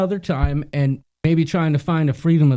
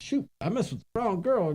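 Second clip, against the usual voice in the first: sheepish; 5 semitones higher, 8 dB quieter than usual. Retold or imitated. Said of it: "he quickly was like".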